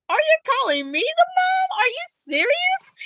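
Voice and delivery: high-pitched acted disbelief; astonished parent, pitched right up; incredulous retake. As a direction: ask it high, in stunned protest. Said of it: "I didn't say it like that, but I'm like".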